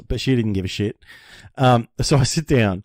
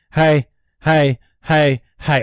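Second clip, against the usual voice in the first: louder, urgent hey at the window; panicked calling; 4 semitones higher, 5 dB louder, much slower than usual. Retold or imitated. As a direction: flag down the receptionist in a panic. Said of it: "I was freaking out".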